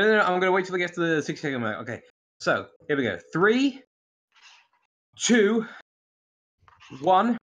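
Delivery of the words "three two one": mock countdown; dramatic countdown. Shown as a demonstration. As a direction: count it down like a launch.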